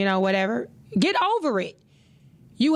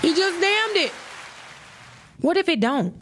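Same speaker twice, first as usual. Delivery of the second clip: much higher, pitched-up retake of "damned"; mock high repeat; 9 semitones higher than usual. Shown as a demonstration. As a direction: toss "damned" up high like a scoff. Repeats line for emphasis.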